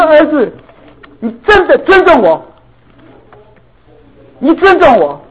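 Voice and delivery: high, loud tearful plea; tearful, emotional father speaking to his son; moved to tears. Retold or imitated. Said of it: "这感动了，我流泪啊".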